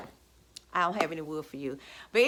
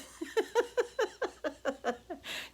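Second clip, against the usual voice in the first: high giggle; 10 semitones higher, 7 dB quieter than usual. Real, not acted.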